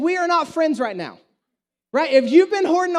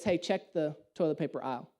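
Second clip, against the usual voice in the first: inner voice; 9 semitones lower, 10 dB quieter than usual. Retold or imitated. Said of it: "something in me just says".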